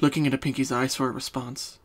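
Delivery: usual speaking voice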